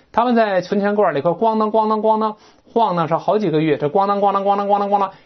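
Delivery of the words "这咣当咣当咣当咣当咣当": quick rattling 咣当 noises; imitated rattling. Shown as a demonstration. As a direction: rattle it like coins in a box. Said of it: "硬物撞击发出的咣当咣当这个声音".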